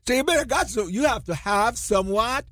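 ordinary delivery

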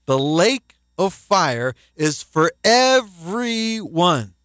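slow, each word weighed; preacher slowing to a solemn warning; slow weighty warning. Acted. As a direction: let "everyone" hang in the air. Sermon warning, slowed.

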